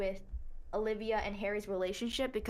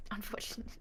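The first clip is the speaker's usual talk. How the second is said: breathy giggle into a whisper; laughing whisper; 7 dB quieter, much faster than usual. Real, not acted. Laughing whisper about bad plot.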